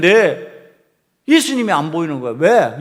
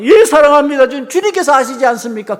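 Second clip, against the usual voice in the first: higher, louder, urgent reply; pleading loud answer; 9 semitones higher, 6 dB louder than usual. Acted. Peter answering Jesus the third time, a loud urgent plea. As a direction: cry it out, almost desperate to be believed. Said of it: "세 번 질문을 합니다".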